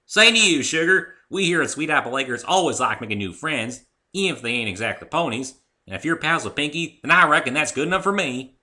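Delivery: hearty farm mare welcoming a friend; Applejack's voice. Acted.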